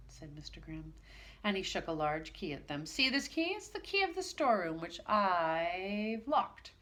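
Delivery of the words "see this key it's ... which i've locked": gloating. Acted. Grimm gloats with key.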